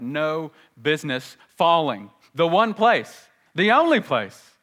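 building to loud, high insistence; emphatic preaching. Real, not acted. Preacher on where God's wrath fell, loud and emphatic.

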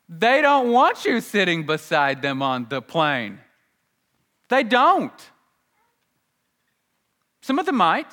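loud, rising to a high pitch; emphatic preaching. Real, not acted. Preacher warns congregation about celebrities, loud and emphatic. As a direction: punch out the blunt point.

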